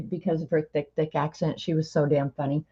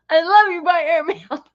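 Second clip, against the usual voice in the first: high, loud mock exasperation; imitated frustration; 13 semitones higher, 5 dB louder than usual. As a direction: squeal it like a fed-up German teacher. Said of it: "she'd get so frustrated with me".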